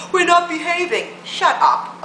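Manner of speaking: lightly mocking quote of scolds; mock complainers. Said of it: "those people on the right saying".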